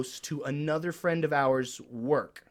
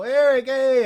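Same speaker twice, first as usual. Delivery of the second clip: high, loud called-out greeting; hailing call; 10 semitones higher, 7 dB louder than usual. Acted. Deli crowd greeting the regular by name, high loud call. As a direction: hail a well-known regular across the shop. Imitating people calling out a greeting.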